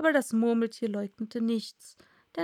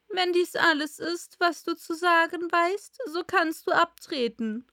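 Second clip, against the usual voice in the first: higher-pitched royal dismissal; König; 7 semitones higher, 3 dB louder than usual. Acted. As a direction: dismiss the witness as the king. King dismisses the witness. (königlich entlassend, mit höherer Stimme)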